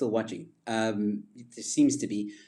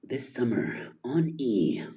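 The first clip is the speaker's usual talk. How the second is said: slow, breathy hushed trailer voice; hushed whispered trailer voice; movie trailer voice; slower than usual. Shown as a demonstration. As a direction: tease the summer release in a whisper. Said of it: "like, movie trailer voice".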